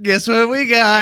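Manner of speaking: high, loud, drawn-out announcement; gleeful mock reveal. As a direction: crow it slowly like a big reveal. Retells revealing the stolen skillet.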